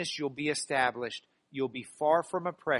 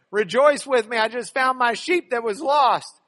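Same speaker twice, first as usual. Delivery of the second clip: louder, higher rejoicing shout; joyful call; 6 semitones higher, 8 dB louder than usual. Acted. Shepherd calling his neighbours to celebrate the found sheep, joyful shout. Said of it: "Actually, he doesn't say that".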